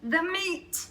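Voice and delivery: high, loud greedy holler. A narrator's reading. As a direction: demand the meat at the top of your voice. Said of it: "we all hollered".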